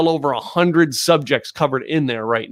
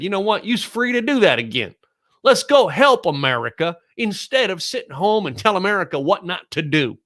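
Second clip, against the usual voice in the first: higher-pitched mock Texas accent, lightly done; 7 semitones higher than usual. Quoting the Texas governor to listeners, mild put-on Texas accent. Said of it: "I'm trying to put my best Texas accent behind that".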